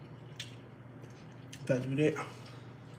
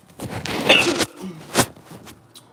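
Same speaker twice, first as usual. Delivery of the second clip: hacking cough from choking; 3 semitones higher, 8 dB louder than usual. Real, not acted. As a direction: cough hard, still choking. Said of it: "I'm still choking off that food".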